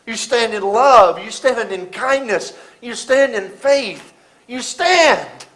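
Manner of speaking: slow, loud preaching build; slow, rising sermon cadence; preaching refrain. Acted. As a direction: drive each stand home.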